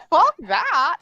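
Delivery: high-pitched indignant protest; defiant retort. Retold or imitated. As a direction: refuse to take no for an answer. Acting out refusal to accept no.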